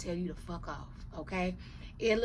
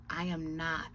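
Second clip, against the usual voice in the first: ordinary talk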